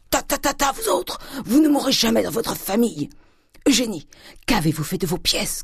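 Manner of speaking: high, scornful outburst; angry cry. A narrator's reading. Grandet turning on wife and daughter, angry cry. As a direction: brush them off high, then demand it angrily. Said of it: "cria-t-il en fondant sur elle".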